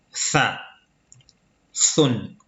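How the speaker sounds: "Sa, sun" begun with a th sound, as in think; tongue tip between the teeth on "Sa, sun"; interdental consonant. Shown as a demonstration. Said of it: "interdentale, le bout de la langue entre les incisives".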